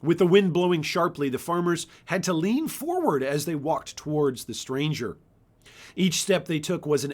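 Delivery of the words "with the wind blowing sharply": ordinary delivery, nothing marked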